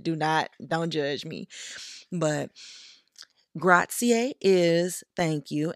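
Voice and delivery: ordinary talk, nothing marked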